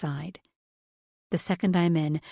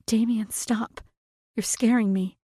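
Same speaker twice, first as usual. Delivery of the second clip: scared; 5 semitones higher than usual. A narrator's reading. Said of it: "how weak my voice sounds".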